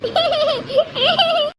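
high, drawn-out giggle; happy giggle. Acted. Child reassured by aunt's affection, giggling. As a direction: giggle with pleased relief.

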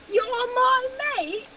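high, slow, hammed-up Cockney. Showing how mate would sound better, full Cockney. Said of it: "you went full Cockney".

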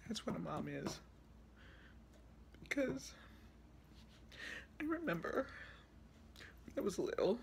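high, choked-up recollection with long pauses; tearful. Real, not acted. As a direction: struggle to get each phrase out through tears. Tearful tribute to mother continues.

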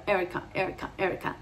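"Erika" softly, with English R; "Erika" the American way, gentle R; closed English vowels. Shown as a demonstration. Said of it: "también se cierra".

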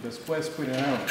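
Spanish read in a heavy Wisconsin accent. Shown as a demonstration. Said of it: "I have a terrible Wisconsin accent".

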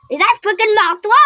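high, loud excited yell. Real, not acted. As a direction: shout it out, thrilled. Building excitement.

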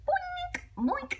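very high boink; spring up on each boink; playful boink. Acted. Dad's ears hitting branches, very high boinks.

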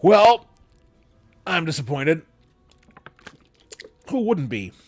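plain reading voice

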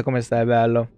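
usual speaking voice

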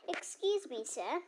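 ordinary delivery

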